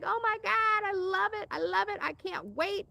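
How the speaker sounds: high, squealing delighted grandma; thrilled grandma. Retold or imitated. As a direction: play a gushing grandma, way up high. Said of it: "She's like".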